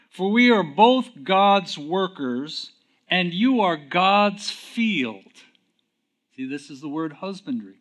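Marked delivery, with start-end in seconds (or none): 0.1-5.3 slow, raised reading that builds louder; rising scripture reading. Acted. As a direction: read it out like Paul speaking.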